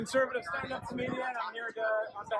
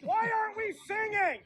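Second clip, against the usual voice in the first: rousing shout; 12 semitones higher than usual. Acted. Rousing crowd.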